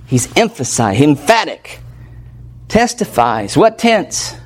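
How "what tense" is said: usual speaking voice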